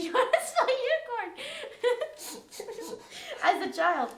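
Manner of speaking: high helpless laughter; laughing. Real, not acted. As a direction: laugh through the words. Laughing hard mid-story.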